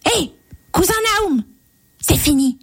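very high tiny-man shout to an unseen servant; calling out. A narrator's reading. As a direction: pipe it up high and quick. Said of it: "Puis il cria". (petit homme qui crie d'une voix aiguë)

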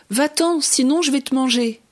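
high, loud warning cry; threatening shout. Acted. Little tyrannosaurus threatening to eat Molo, loud shout. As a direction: bark a threat to scare him away. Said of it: "lui cria le petit tyrannosaure".

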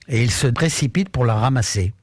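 usual speaking voice

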